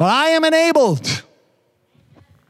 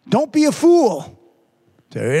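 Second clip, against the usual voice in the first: ordinary talk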